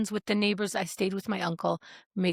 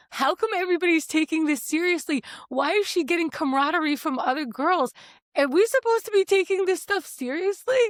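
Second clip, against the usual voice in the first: high-pitched incredulous questioning; acted bewilderment; 7 semitones higher than usual. Kid baffled that others take it seriously, acted disbelief. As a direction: replay a baffled kid demanding answers. Bewildered outburst at classmates' reactions.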